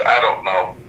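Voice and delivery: usual speaking voice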